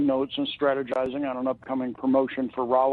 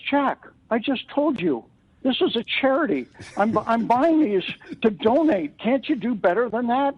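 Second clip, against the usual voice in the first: mock indignant protest; 8 semitones higher than usual. Buyer haggles with Jack over reels for charity, mildly indignant. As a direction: act out an exasperated customer's appeal.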